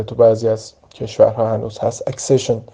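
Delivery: ordinary delivery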